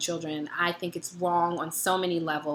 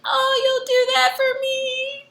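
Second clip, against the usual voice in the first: very high, slow, melting voice; mock swooning; 4 dB louder, slower than usual. Answering her boyfriend's promise to call back, in a very high, slow, swooning voice. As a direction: gush at him, pitched way up and drawn out.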